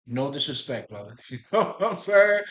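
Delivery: ordinary delivery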